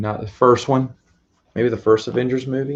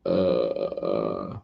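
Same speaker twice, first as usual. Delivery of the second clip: a low, eerie croak from the throat; creepy ghost croak dragged out low; Grudge girl croak; 11 semitones lower, 7 dB quieter than usual. Acted. Streamer imitates the Grudge ghost girl's low croak. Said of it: "that's the girl from The Grudge".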